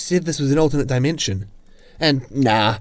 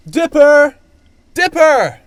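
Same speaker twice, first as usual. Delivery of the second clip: loud high urgent call; 13 semitones higher, 8 dB louder, slower than usual. Acted. Guide calls out searching for Dipper, loud call. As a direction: shout his name across the woods. Calling out for lost boy.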